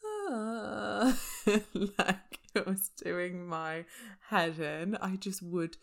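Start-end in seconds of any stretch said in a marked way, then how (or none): 0.0-1.2 imitating a wheezy breath; a high breathy wheeze sound. To listeners, imitating her wheeze as she dozes off. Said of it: "felt myself going".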